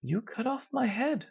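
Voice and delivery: a high, soft whisper; hushed accusation. A narrator's reading. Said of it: "I whisper".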